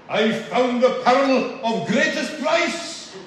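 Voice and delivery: loud, slow Glaswegian preacher, pitched high; Glaswegian accent. Retold or imitated. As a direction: imitate a fervent Glasgow evangelist's accent. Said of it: "apologise in advance for attempting his accent".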